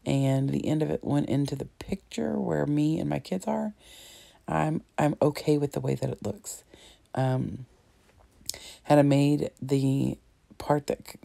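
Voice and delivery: usual speaking voice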